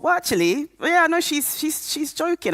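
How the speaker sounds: high-pitched, hesitant disbelief; retold denial. Patient doubting her doctor after looking online, retold denial. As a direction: talk yourself out of it, voice pitched right up.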